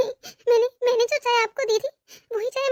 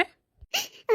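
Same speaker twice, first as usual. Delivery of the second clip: soft, high groan of hurt; faint high whimper of pain; 7 semitones higher, 7 dB quieter than usual. Acted. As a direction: let out a weak, aching moan. Hurt wife groans to husband.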